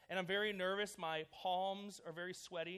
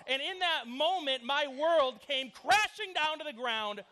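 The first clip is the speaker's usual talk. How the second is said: mock tragedy; 5 semitones higher, 9 dB louder, slower than usual. Mock tragic storytelling.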